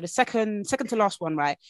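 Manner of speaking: usual speaking voice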